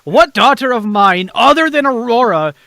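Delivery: stern father. Acted. Father scolding his daughter for the orphans, loud and outraged. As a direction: thunder at her like an outraged dad. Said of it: "walk directly up to you and say".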